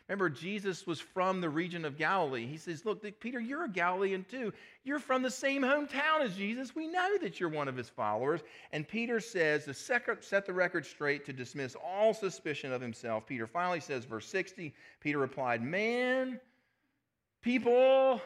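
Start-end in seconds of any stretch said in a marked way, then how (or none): 2.8-8.4 mock accuser. Accuser points out Galilean. 15.6-18.2 higher, louder call to the crowd. Acted. Peter calling out to the campfire crowd, loud and high.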